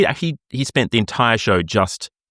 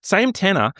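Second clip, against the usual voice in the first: ordinary delivery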